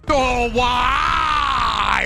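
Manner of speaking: high, long drawn-out wail; anguished scream. Real, not acted. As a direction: wail it out in frustration. Gamer screaming at the game.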